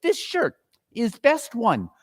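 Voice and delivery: high, cackling crone gloats over the loot; gloating old charwoman. Acted. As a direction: relish every stolen thing.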